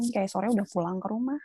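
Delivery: ordinary delivery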